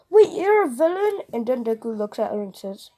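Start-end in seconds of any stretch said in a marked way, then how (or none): 0.1-1.2 high, loud disbelieving question; sudden high, loud surprise; shocked. Acted. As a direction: gasp it out in disbelief. Uraraka shocked at the reveal.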